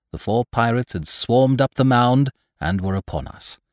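usual speaking voice

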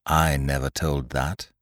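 ordinary delivery

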